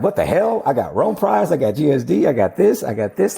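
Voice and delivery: overjoyed, can't believe it; excited. Retold or imitated. Retells disbelief at sweeping the prizes.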